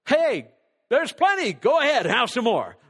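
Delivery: loud, high call to the table; acted host calling out generously; hearty urging. Acted host urging second helpings.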